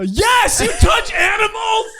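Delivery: high, loud disgusted shout. Real, not acted. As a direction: yell it in disgust.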